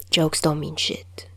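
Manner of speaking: slow, whispered dismissal; slow whispered comeback; whispered deadpan. Acted. Deadpan retort.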